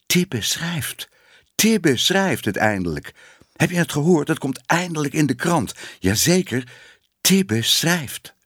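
high-pitched excited gossip; excited news-spreading. A narrator's reading. Cats passing news over the rooftops, excited. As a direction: pass on the big news in a high, eager voice.

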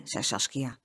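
plain reading voice

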